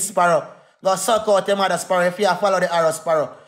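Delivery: ordinary talk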